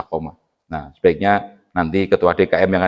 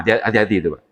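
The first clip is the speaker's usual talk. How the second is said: ordinary talk, nothing marked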